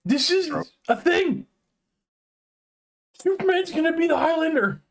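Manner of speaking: very high, thrilled disbelief; high-pitched real excitement; excited. Real, not acted. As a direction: squeal the news like an overjoyed fan. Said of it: "I'm excited".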